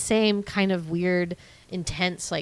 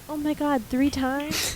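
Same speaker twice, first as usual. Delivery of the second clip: higher-pitched astonished question; mock amazement; 8 semitones higher than usual. Woman to her lover in retold scene, mock amazement. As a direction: play her disbelief, pitched up.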